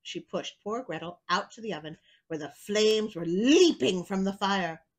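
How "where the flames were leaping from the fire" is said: ordinary delivery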